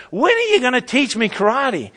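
student explodes at Mister Miyagi in rage; exploding in rage. Retold or imitated. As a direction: let the student blow up at his master. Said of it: "in a fit of rage, he just explodes".

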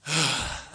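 long low weary sigh; deep sigh. Shown as a demonstration. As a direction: let out a heavy, frustrated sigh. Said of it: "that deep sigh".

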